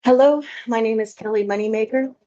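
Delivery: ordinary talk, nothing marked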